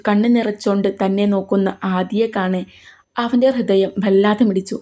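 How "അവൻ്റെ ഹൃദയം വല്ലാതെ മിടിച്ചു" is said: ordinary delivery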